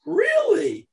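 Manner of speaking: leaps up high in delight; can't believe his luck; giddy disbelief; thrilled surprise. Acted. Haman delighted by invitation.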